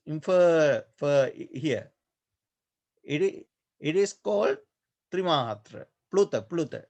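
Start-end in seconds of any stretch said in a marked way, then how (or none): 0.1-1.3 "fer" held long; lengthened "fer" in "Infer, fer"; extra-long syllable. Shown as a demonstration. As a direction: stretch out "fer" to show its length. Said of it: "is here three syllables".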